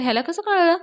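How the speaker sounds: high, puzzled questioning; surprised. A narrator's reading. Avni wonders how Raja knows, high-pitched surprise. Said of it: "अवनीला आश्चर्य वाटतं".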